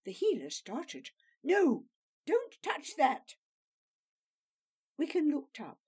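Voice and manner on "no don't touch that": sharp, loud, slow warning cry; alarmed shout. Acted. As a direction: stop him before he touches it. Healer alarmed at his touch.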